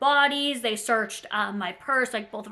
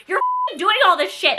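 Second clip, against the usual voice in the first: high, loud angry scolding; angry outburst; 10 semitones higher, 9 dB louder than usual. Retold or imitated. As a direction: snap at your partner, furious. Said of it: "now mad at Austin".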